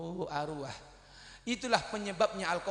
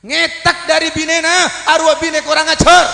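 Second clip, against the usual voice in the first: loud, high scolding; scornful outburst; 11 semitones higher, 18 dB louder than usual. Acted. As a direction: condemn them with contempt. Condemning ungrateful sons. (kecaman keras kepada anak durhaka)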